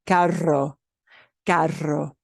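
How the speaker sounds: carro, carro with strong trilled rr; rolled r. Shown as a demonstration. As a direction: keep the tongue flapping on the rr of carro. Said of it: "let the tongue flap more".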